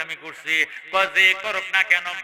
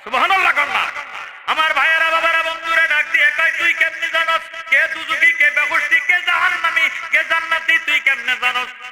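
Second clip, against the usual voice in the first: high, loud chanted challenge; full-force preaching; 11 semitones higher, 6 dB louder than usual. Acted. Preacher retelling the father's challenge to the crowd, high chanted preaching.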